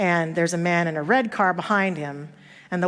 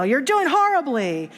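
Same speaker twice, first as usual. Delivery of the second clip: raised, high mock scolding; retold yell; 6 semitones higher than usual. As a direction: imitate a driver yelling criticism. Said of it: "he yells at him and says".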